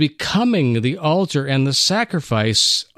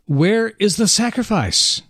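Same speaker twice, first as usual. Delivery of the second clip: pleading son; 7 semitones higher than usual. Acted. Son asking again, pleading.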